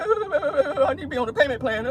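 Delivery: high, soft fake blubbering; Tasha K crying. Tasha K pleading on live, mock crying. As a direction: whimper a plea with hammed-up sobs. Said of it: "That was her ass on like".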